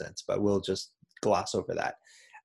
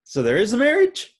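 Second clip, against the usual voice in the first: hammed-up confused father, higher, louder; higher mock puzzled dad; 12 semitones higher, 7 dB louder than usual. Retelling the dad's confusion, hammed-up higher voice. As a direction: play the dad baffled and bright. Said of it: "Ronnie's like".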